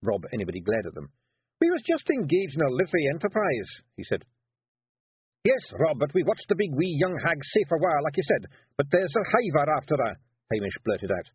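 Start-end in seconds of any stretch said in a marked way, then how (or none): 1.6-3.7 Scots pixie voice, higher and louder; gruff little Scots warrior caught out; Feegle Rob. Acted. Rob defending himself to the lads, Scots pixie voice. 5.4-10.2 excited Scots pixie, higher and louder; Feegle Hamish. Acted. Hamish warning Rob about the hiver, blurting Scots pixie.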